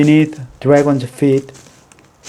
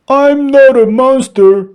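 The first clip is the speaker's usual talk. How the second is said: higher, louder dragon character voice; dragon's voice; 9 semitones higher, 5 dB louder than usual. A narrator's reading. Dragon telling the frightened animals he's harmless, earnest. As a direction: reassure them, a bit hurt. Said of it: "said Dragon".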